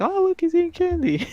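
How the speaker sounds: high, airy and offhand, like it's nothing; mock nonchalant.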